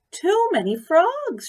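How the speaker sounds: high, loud wail of dismay; high loud despairing cry; cried out. A narrator's reading. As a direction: cry it out in alarm. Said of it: "she cried".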